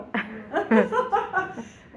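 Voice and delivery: laughter fading out; laughing. Real, not acted. Teacher laughs at her own doctor's handwriting, fading laugh. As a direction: still giggling at yourself.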